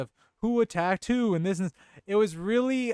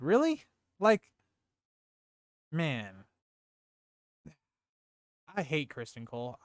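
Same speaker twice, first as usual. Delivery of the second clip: normal talking voice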